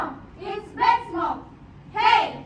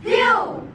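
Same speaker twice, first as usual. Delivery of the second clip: disgusted phew; 3 semitones higher than usual. Acted. Reacting to bad smell.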